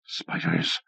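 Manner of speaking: breathless unvoiced whisper of dread; whispered horror. A narrator's reading. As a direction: hiss it in terror.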